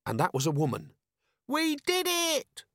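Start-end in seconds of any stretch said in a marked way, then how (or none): 1.5-2.7 very high, drawn-out desperate wail; much higher voice. A narrator's reading. Terrified robber confessing from inside, much higher. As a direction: wail it at the top of the range. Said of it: "the voice was a lot higher".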